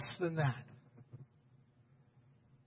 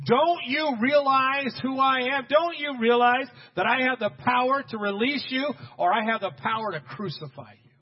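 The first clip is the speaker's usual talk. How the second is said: raised, imperious demand, easing at the end; Pilate; 5 semitones higher, 5 dB louder than usual. Acted. Pilate threatening Jesus with his power, demanding. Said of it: "Pilate demanded".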